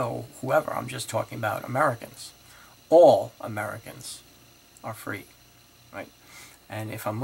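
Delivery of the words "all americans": "All" hit loud with a rising tune; stress on all. Shown as a demonstration. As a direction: punch "All" before "Americans". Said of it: "the word all is qualified".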